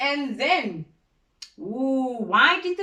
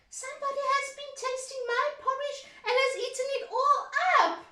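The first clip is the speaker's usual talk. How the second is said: very high, fast little cub; high, quick baby bear cry; tiny wee bear voice; faster than usual. Acted. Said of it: "cried little wee bear in his wee voice".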